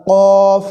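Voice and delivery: কফ as a long, heavy "qoof"; back-of-tongue articulation. Shown as a demonstration. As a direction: hold কফ loud from the root of the tongue. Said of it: "জিহ্বার গোড়া থেকে".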